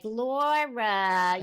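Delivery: put-on calling a name in a mother's Brooklyn accent; a hint of a Brooklyn mom calling Laura. Actress imitates her Brooklyn mother calling her, Brooklyn accent. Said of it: "So it would be like".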